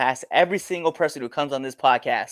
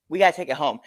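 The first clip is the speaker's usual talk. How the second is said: usual speaking voice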